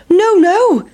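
very high, sing-out refusal; defiant pancake. Acted. Pancake to hungry boys, defiant.

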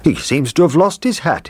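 a lightly voiced cheerful cook; cook's voice. A narrator's reading. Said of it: "he said".

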